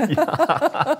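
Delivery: a high, loud laughing "Ja"; laughing yes. Real, not acted. Host confirming to the guest while laughing. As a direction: answer through the laugh.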